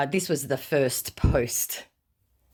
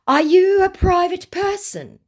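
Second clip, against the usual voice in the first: very high, loud, put-on questioning; hammed-up squeaky-high scammer question; mock scammer; 8 dB louder than usual. Mimics scammer's probing question.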